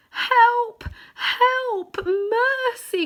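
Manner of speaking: plain reading voice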